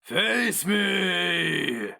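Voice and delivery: very high, slow, shrieking creature shout; monstrous shouted challenge. A narrator's reading. The Inkoroi challenging the hero mage, shrieking creature shout.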